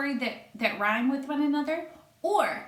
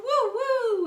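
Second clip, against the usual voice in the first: much higher, louder woo; dog bark; 13 semitones higher, 6 dB louder than usual. Acted. Daunted dog barking, high loud woo. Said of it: "who barked woo".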